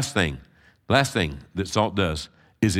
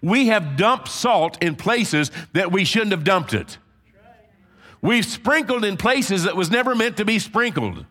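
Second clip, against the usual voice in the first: raised, driving preacher's cadence; full-force preaching; 11 semitones higher, 3 dB louder than usual. Acted. Pastor rallying the congregation, preaching hard. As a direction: pound each phrase home from the pulpit.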